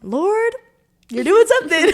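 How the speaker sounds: overwhelmed with joy; joyful awe. Retold or imitated. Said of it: "emotional prayer".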